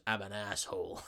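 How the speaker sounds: low, soft mock tough-guy voice; gruff Snake impression.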